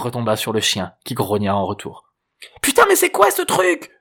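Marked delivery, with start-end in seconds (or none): 2.6-3.9 very high, loud shriek of disgust; shrill startled outburst; yelling girl. A narrator's reading. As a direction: yell it as a startled teenage girl. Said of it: "hurla la fille".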